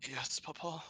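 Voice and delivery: small, soft, high-pitched questioning voice; afraid child. Acted. Player as Cecil answering his father, timid afraid child. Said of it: "zapped back to afraid child at the sound of this voice".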